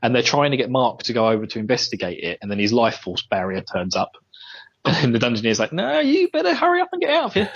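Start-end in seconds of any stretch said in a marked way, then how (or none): 5.7-7.5 high put-on game host voice; hammed-up high dungeoneer; mock dungeon master. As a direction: squeak it out like a silly host. Dungeoneer voice quoted.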